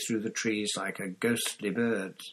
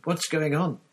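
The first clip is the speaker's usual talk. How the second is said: high, loud alarmed question; cried out; 9 semitones higher, 5 dB louder than usual. A narrator's reading. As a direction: demand an answer in shock, pitched up. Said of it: "he cried".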